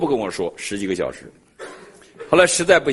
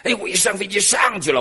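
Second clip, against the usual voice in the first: ordinary delivery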